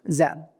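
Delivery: the letter ذ turned into زاء, a z sound; dal as zay. Shown as a demonstration. Teacher demonstrating to Quran students the z slip for the ذ sound. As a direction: say زاء with a plain buzzing z in place of the th sound. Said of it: "الذين ينطقون مثلًا الزال ينطقون إيش؟".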